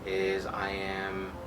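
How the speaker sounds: soft, hushed meditation voice; guided meditation. Shown as a demonstration. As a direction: guide them gently into the breath, softly.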